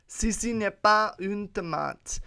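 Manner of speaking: showy French. Acted. Podcaster translating the caption for listeners, showy French. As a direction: read it out grandly in French. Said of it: "The French".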